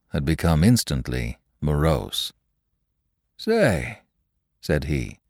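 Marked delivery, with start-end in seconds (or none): 3.4-4.0 mystified. Acted.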